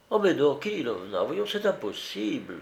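weak objection to the maid; wavering protest. A narrator's reading. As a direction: plead that it cannot be done. Madame wavers, protests to servant.

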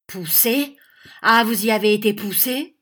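loud, rising mock questions; mocking echo. A narrator's reading. Schoolmaster to the boy who made an excuse, mocking echo. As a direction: throw the excuse back in mockery.

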